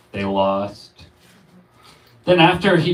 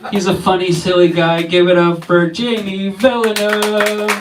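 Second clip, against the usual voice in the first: host announcement; 7 semitones higher, 6 dB louder than usual. Real, not acted. Host announcing next comic.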